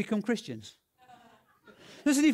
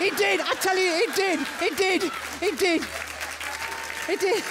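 loud, much higher-pitched protest; excited insistence; 9 semitones higher, 4 dB louder than usual. Real, not acted. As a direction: insist on it loudly, pitch right up. Insisting the miracle really happened.

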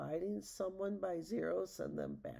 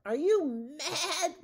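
high, very loud, drawn-out outrage; bellow; 10 semitones higher, 11 dB louder, much slower than usual. A narrator's reading. Rumpelstiltskin sees Matilda grab the staff, outraged high bellow. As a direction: stretch it into a huge incredulous shout. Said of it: "Rumpelstiltskin bellowed".

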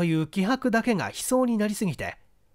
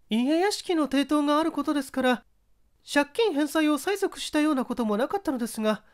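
voiced lady witness; 8 semitones higher than usual. Widow answering the detective, higher polite voice. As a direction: speak up high as a courteous widow. Mrs. Okawa's testimony voiced.

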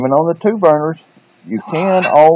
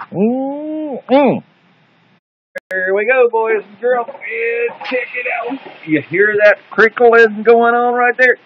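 put-on kids show host; 8 semitones higher, slower than usual. High host voice again.

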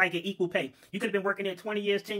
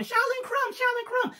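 falsetto calling out, much higher; high calling boss; slower than usual. Acted. Boss lady calling her worker from afar, high falsetto cry. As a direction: call his name like a distressed lady. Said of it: "she kept calling".

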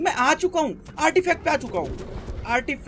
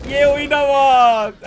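slow, louder drawn-out praise; pleased cheer; 2 semitones higher, 8 dB louder, much slower than usual. Real, not acted. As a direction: savour the moment. Praising teammate after kill. (खुशी से खींचकर बोलना)

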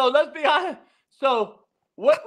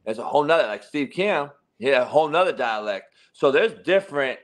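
much lower, trailing mumble; self-aware mumbling; 12 semitones lower than usual. Real, not acted. Host admitting he sounds Chinese, low mumbling. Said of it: "I'm sounding Chinese right now".

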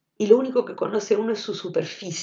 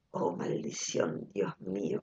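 whispered lyric; 12 dB quieter, slower than usual. Retold or imitated. Quoting a song chorus to listeners, in a whisper. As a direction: whisper the song line like a secret. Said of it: "un estribillo que es tremendo".

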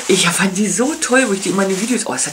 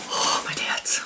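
breathless whispered swoon; hushed sigh of adoration; 6 dB quieter, slower than usual. Real, not acted. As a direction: whisper it as if overcome. Overwhelmed by high-end products.

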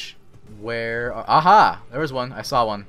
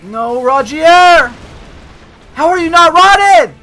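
dismayed shout; 11 semitones higher, 14 dB louder than usual. Real, not acted. Gamer crying out as teammate Rogier falls, loud and high. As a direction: yell it as if he's doomed.

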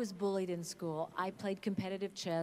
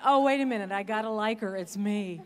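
loud, raised comic double take; acted sudden realization; 3 semitones higher, 10 dB louder than usual. Actress recalling first watching herself on screen, comic realization. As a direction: burst out as if just catching yourself. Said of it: "And I went".

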